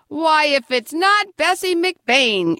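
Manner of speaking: put-on delighted to spot an old friend; exclaiming. A narrator's reading. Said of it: "exclaimed Grandpa".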